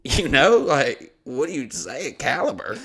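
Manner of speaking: usual speaking voice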